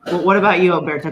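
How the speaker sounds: ordinary talk, nothing marked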